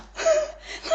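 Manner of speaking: sudden high burst of laughter; cracking up at the memory; real laugh breaking out. Real, not acted.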